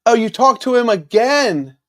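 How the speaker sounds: high, delighted surprise; pleasantly surprised. Real, not acted. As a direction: react to unexpected good news. Reacts to news in live chat.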